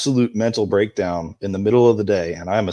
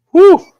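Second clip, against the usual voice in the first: loud relieved whew; 13 dB louder than usual. Real, not acted. After recalling son's comfort.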